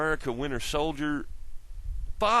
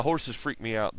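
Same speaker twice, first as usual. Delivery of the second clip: ordinary talk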